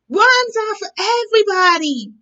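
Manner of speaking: high, loud and fast cry; cried out. Acted. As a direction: chase him crying out. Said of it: "they cried".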